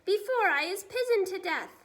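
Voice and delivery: high, loud panicked yell; frantic giant yelling for his life; shouted. A narrator's reading. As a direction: scream it in terror of dying. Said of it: "shouted the flesh lump eater".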